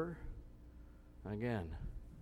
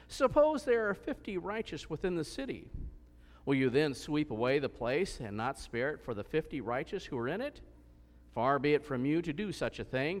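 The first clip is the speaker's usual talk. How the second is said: steady, raised reading voice; scripture reading; 4 semitones higher, 4 dB louder than usual. Acted. Preacher reads Genesis aloud to the congregation, steady reading voice. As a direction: read the Bible passage out to the congregation.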